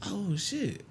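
high, soft, stunned aside; hushed inner reaction. Retold or imitated. Comedian recalling seeing a star, hushed inner reaction. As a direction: breathe it out softly, as if to yourself. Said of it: "I'm thinking in my head".

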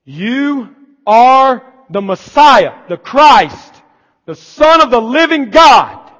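loud, slow, high proclamation; bold confession. Acted. Peter declaring Jesus Messiah, loud and slow. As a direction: proclaim it like a man risking stoning.

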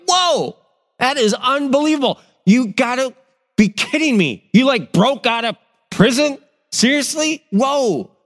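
high, excited whoa of disbelief; hammed-up amazement at a friend back from prison; astonished disbelief. Disciples amazed Peter is free.

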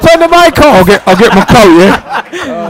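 loud, high, helpless laughter; embarrassed laughter after his accidental innuendo; laughing loudly. Real, not acted. Embarrassed laughter, asks mic off.

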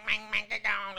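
drawn-out raspy whine. Retold or imitated. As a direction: moan it out raspy and needy. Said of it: "like that, but, uh, for fucking hours".

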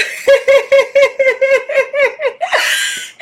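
high, loud burst of laughter; delighted laugh. Real, not acted. Laughing after the dance.

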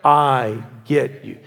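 slow, weighty, every word set apart; slow spoken title. Shown as a demonstration. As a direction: say the title like a vow. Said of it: "please say it with me".

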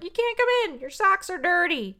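high, prim witch voice; witch refusing. Retold or imitated. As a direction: refuse her in a lifted mock-witch tone. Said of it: "And the witch is like".

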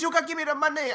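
high-pitched insistent woman; mock angry demand. Caller insisting on her money, mock angry demand.

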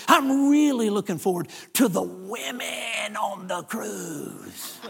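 slow, drawn-out mock lechery, sinking away at the end; stretched words trailing low and soft; leering imitation. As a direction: mimic a lecher savoring every word. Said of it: "That's exactly the way he said".

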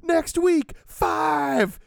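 high excited yell; excited screaming. Retold or imitated. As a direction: scream it out with glee. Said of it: "excitedly screaming it to everyone".

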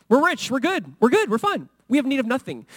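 high, loud smug bragging; mock boasting. Preacher mimics the Laodiceans' boast, smug and high. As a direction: puff up like a rich city showing off. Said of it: "they're boasting".

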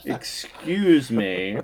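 drawn-out, affected "excuse me"; hammed-up genteel politeness; mock proper talker. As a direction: play absurdly refined, stretching the words. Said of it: "talking so proper".

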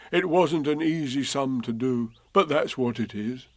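gentle bear asking a friend; mild Pooh voice; lightly voiced Pooh bear.

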